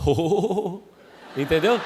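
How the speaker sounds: mock prophet groan; hammed-up prophet "Oh". Shown as a demonstration. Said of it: "você precisa fazer".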